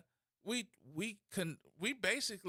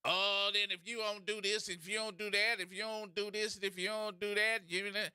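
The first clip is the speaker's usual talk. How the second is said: rapid monotone auction chant, run together; mock an auctioneer's patter; auctioneer imitation. Comedian mocking how someone talked, in a singsong auctioneer chant. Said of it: "sounded like you was damn auctioning something off".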